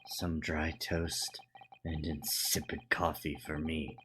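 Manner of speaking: ordinary talk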